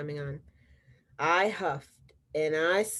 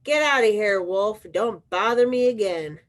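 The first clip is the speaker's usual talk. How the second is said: louder, snapping at the wolf; rude shout; 3 semitones higher, 6 dB louder than usual. Acted. Pig yelling through the door at the wolf, rude and loud. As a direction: bark the wolf off the doorstep.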